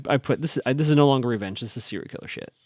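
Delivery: ordinary delivery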